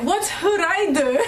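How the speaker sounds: retold puzzlement, on the edge of laughing; baffled question. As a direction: act out being stumped by a menu word. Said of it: "so we're like".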